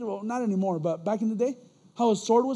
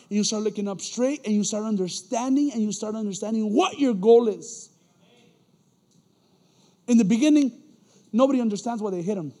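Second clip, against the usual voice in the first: loud, high fervent sermon delivery, louder and higher; preaching; 5 semitones higher, 7 dB louder than usual. Acted. Preacher exhorting the church about being formed, loud. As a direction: drive it home like a pastor building up.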